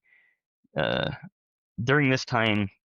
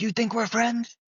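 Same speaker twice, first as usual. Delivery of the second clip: much higher mock-angry voice, pitched way up; mocking Elder Justin; 11 semitones higher than usual. Retelling the elder scolding him on the phone, mock-indignant high voice. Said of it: "He's like".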